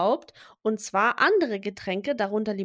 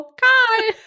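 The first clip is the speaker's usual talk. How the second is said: loud, high winner announcement; hyped giveaway announcement; 11 semitones higher, 9 dB louder than usual. Pretend. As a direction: shout the winner's name. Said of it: "und Sarah so".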